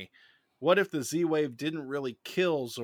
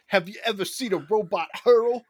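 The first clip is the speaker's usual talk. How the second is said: high, loud mock robot voice; louder high teasing robot question; Alpha Six quote; 8 semitones higher, 6 dB louder than usual. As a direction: needle them like a bratty robot. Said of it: "Alpha Six comes up and goes".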